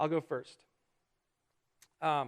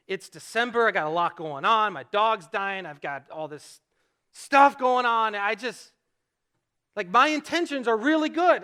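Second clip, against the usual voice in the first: acted exasperation, louder and higher; frustrated outburst; 3 semitones higher, 6 dB louder than usual. Husband pleading good intentions in a fight with his wife, frustrated. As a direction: pile up excuses, worked up but not raging. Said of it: "I was so frustrated".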